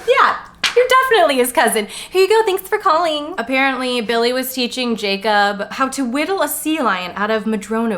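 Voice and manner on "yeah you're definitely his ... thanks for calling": chirpy genealogist. Retold or imitated. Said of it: "She's like".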